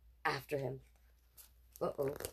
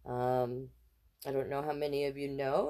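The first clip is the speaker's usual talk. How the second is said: ordinary delivery, nothing marked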